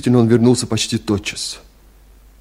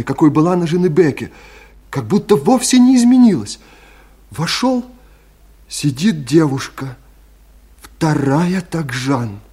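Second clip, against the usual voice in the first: high, rising astonishment; amazed; 5 semitones higher than usual. Acted. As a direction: marvel at an uncanny likeness. Ербол describes the astonishing double. (изумлённо, взволнованно)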